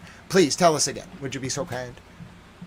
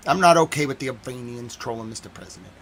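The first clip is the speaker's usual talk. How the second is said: ordinary delivery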